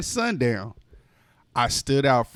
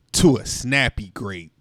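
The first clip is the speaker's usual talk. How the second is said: ordinary delivery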